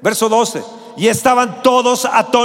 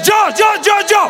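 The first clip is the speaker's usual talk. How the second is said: eager volunteer; 8 semitones higher, much faster than usual. Pretend. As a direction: leap up and shout to be first. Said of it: "le dirías".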